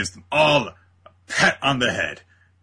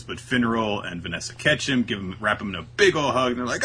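ordinary talk, nothing marked

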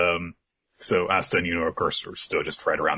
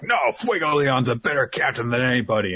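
mild mock outrage; 6 semitones higher than usual. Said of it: "Leo immediately gets pissed off".

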